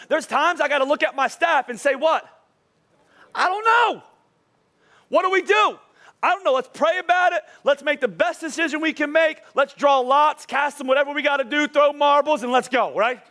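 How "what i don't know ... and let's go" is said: high-pitched mock-flustered outburst; exaggerated, high, animated cluelessness; acted bewilderment. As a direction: play a clueless boss throwing up ideas. Acts out himself before his staff.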